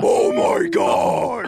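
ecstatic shout. Acted. High on the drink, ecstatic.

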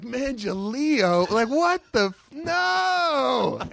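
high, shocked disbelief rising to a shout; mock outrage. Hearing Hogan botch his name on air, mock outraged shout. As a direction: recoil at hearing the name wrong. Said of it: "I was like".

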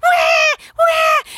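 high, loud toy-doll wah cry; mock doll cry. Mom imitating the doll's recorded cry. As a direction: imitate a crying doll's wail. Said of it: "it goes".

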